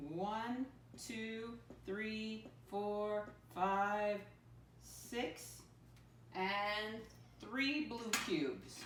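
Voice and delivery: put-on slow, even counting for young learners; counting aloud for kids. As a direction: count each cube out deliberately. Teacher counting cubes aloud.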